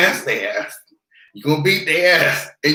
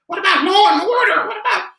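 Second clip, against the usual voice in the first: high, whiny mock outrage; mocking whiny critic; 12 semitones higher than usual. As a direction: squeal it like a clueless critic. Mocking people's law and order.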